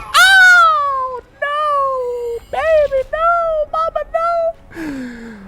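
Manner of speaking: very high, loud falsetto wail; hammed-up sobbing cry fading into a slow whimper; mock crying wail.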